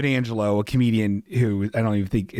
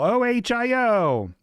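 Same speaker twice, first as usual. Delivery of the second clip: mock cheer; 10 semitones higher, slower than usual. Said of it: "then they yell".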